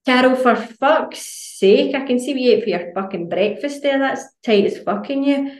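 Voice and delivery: hammed-up savage friend; brutal honesty. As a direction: tease your friend with blunt cruelty. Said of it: "You'd be like".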